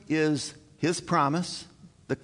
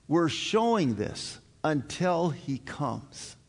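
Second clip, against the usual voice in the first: normal talking voice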